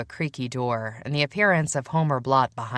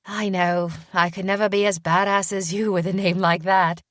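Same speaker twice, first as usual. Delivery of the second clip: put-on faint British accent; 4 semitones higher, 4 dB louder than usual. A narrator's reading. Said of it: "wincing as she glanced my way".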